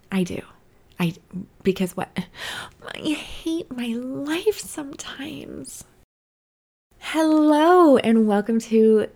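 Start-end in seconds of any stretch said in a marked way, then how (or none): 2.9-5.8 slow whispered lament. Real, not acted. Whispered despair aside. 7.0-9.1 louder slow intro; intro take. Shown as a demonstration. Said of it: "why do I hate all of my hellos".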